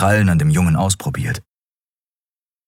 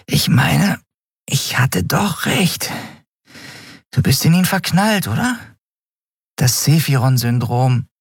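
much higher, sleepy teasing voice; teasing Nelen; 9 semitones higher than usual. Acted. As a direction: needle her knowingly. Nelen teases about Kai.

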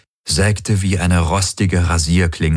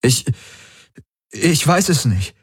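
higher, faltering helplessness; helpless; 6 semitones higher than usual. Acted. Galen admitting to the crew he doesn't know, helpless. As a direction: stammer it out helplessly. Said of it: "hob hilflos die Schultern".

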